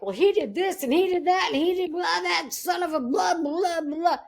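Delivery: high-pitched comic rant at a best friend; imitated venting, pitched well up; mock ranting. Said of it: "rant and rave".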